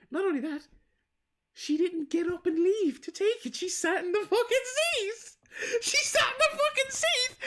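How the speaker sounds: can't believe it, voice climbing into a laugh; incredulous. Real, not acted.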